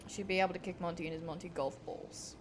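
low, soft, quick redelivery; muted retake. Real, not acted. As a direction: say it quickly under your breath. Redoes the joke under breath.

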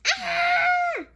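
sudden high squeal of delight; high happy squeal; excited shriek. Real, not acted. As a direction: shriek at the picture. Excited cry at picture.

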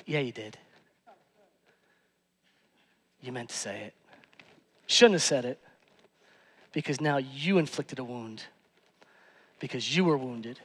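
low, soft sermon confession; quiet. Real, not acted. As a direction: lean in and say it gently.

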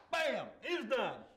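sudden loud, high burst on Bam; a real excited shout mid-sermon; excited outburst. Real, not acted. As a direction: blurt it out, carried away by the story. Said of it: "I get excited sometimes".

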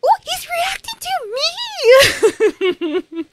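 high, loud and slow, laughing through it; giddy realization. Retold or imitated. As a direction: squeal with delighted disbelief. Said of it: "That's my interpretation of her reactions".

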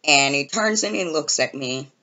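ordinary delivery

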